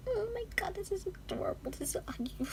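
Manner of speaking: breathless whisper over a cute photo; hushed gushing; awed whisper. Real, not acted. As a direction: whisper it, overwhelmed by cuteness.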